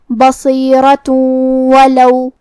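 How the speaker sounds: بصیرۃ ولو, tanween merged into the waw with a nasal hum; slow, nasal blend of بصیرۃ into ولو; idgham with ghunna. Shown as a demonstration. Teacher shows tanween merging into waw, nasal. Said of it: "تنوین کو واو میں ملا کے غنہ اور تشدید".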